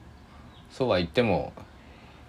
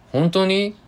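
surprised question; 6 dB louder, slower than usual. Retold or imitated. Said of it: "なんて言ってたんでしょうね".

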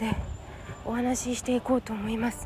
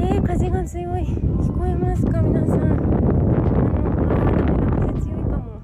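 raised voice against the wind; shouting over wind; 3 semitones higher, 4 dB louder than usual. Real, not acted. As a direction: speak up over gusts.

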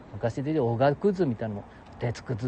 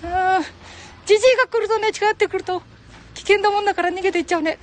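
much higher put-on voice, quick; falsetto second voice; 7 dB louder, faster than usual. Old man voicing a companion, high falsetto. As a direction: answer yourself in a high falsetto character.

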